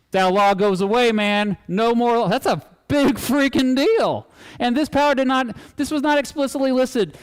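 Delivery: really worked up, almost shouting the point; getting passionate. Real, not acted. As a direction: passionate outburst to wake up the class. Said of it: "Sorry, I'm getting passionate".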